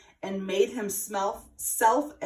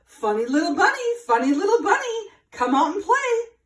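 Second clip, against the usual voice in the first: high, loud call; 9 semitones higher, 6 dB louder than usual. A narrator's reading. Mouse calls down to the bunny, loud and high. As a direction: call down the tree, a little urgent. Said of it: "he called".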